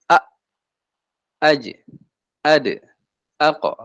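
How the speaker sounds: "A, aj, ade, ako" stopped short; held letters. Shown as a demonstration. Teacher shows held sound, "A, aj, ade, ako" cut short. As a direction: block each sound, then release it briefly. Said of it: "berarti dia ketahan".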